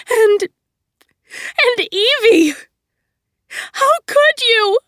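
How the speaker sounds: high, loud, slow crying cry; sobbing. Acted. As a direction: wail her sister's name. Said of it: "a sob swelled in her throat".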